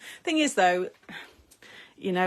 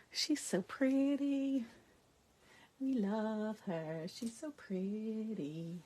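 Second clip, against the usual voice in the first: admiring coo; 2 semitones higher, 4 dB quieter, much slower than usual. Real, not acted. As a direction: gush softly over something lovely. Admiring the card.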